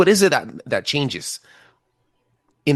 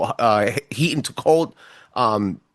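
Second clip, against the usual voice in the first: ordinary delivery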